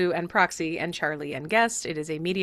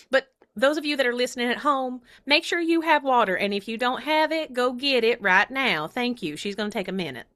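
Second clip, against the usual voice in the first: put-on higher-pitched puppet with a Southern accent; Proxy; 4 semitones higher than usual. Puppet fills in while host drinks, higher accented voice. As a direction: address the listeners in a put-on puppet voice. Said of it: "Proxy has her own voice".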